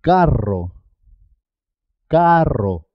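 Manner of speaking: long trilled rr in "Carro"; rolled double R. Shown as a demonstration. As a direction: mark the RR of "Carro" with a vibrating tongue. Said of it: "siempre suena doble".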